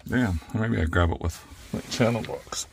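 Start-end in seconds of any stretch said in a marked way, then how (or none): 0.1-0.4 straining while working; strained words under effort; effort grunt. Real, not acted. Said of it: "Sorry about my grunting".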